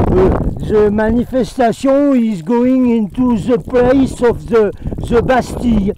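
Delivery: mock the protesters' English; mock French-accented English. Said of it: "vous parlez comme vos faux présidents". (anglais à l'accent français, moqueur et un peu appuyé)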